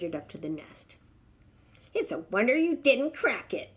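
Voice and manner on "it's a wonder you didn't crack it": sharp mother bird snapping; snapped bird. Acted. Bird snapping at the elephant, mildly cross. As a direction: snap it at him, irritated. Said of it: "snapped the bird".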